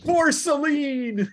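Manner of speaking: high, slow, drawn-out war cry; rally yourself for your lady with a drawn-out cry; battle cry. Acted. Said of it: "He's insane".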